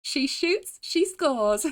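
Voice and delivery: put-on high British-accented sporty cheer; playful British game heroine; fake British accent. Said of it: "do a fake British accent".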